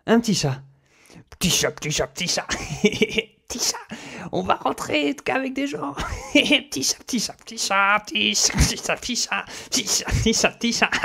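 high, soft sing-song cooing; high crooning baby talk to a cat. Acted.

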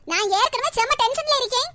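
ordinary talk

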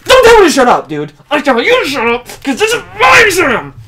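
high furious screaming; screaming angry. Acted. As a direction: scream it at your partner. Staged quarrel, screaming.

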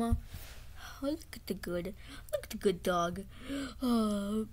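ordinary talk, nothing marked

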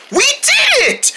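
very high, drawn-out cry of success; triumphant cheer. Pretend. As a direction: celebrate with a squealed cheer. Group celebrates the finished lift.

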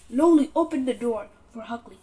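ordinary delivery

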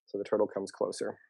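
plain reading voice

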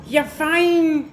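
high, drawn-out praise; pet praise. Real, not acted. Player rewarding Laika for coming, high doting praise.

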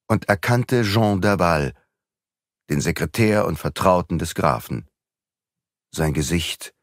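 usual speaking voice

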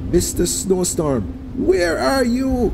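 very high, slow desperate shout. A narrator's reading. Trembling Anansi shouts into the snowstorm, very high. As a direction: cry out into the empty storm. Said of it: "as he kept shouting".

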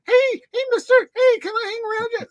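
put-on high, eager begging kid voice; eager young pup. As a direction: mimic a young fan tagging along and pleading. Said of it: "yapping away, going".

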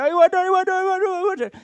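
put-on very high, loud nagging "I want" of a toddler; whining child. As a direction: imitate a child begging in the supermarket. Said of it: "that child".

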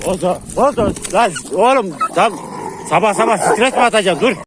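loud, high exasperated yell at the dog. Real, not acted. Vlogger yelling at his dog Bozo in the morning, exasperated shout.

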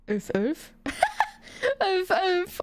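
squealing laugh through the words; half-sobbing laugh at the donation; helpless laughing cry. Real, not acted.

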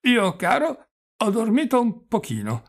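put-on high, loud old man's voice; a dazed old prince piping up; doddering old prince. A narrator's reading. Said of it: "con l'aria inebetita, inerte e avvizzito". (vecchio principe rintronato, voce alta e acuta)

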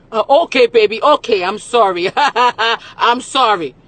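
loud, high, fake sorry; mocking loud apology. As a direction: imitate his loud careless apology. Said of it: "Every time".

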